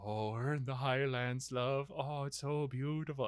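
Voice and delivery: soft, gentle mock husband's comfort; tender reply. Husband comforts his dying wife, soft and tender. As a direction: soothe her softly as she dies. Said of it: "And she's like".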